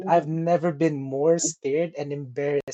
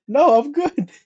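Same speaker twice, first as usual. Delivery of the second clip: high refusal; 9 semitones higher, 6 dB louder, slower than usual. Retold or imitated.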